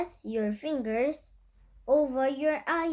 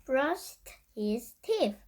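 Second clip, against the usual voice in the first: usual speaking voice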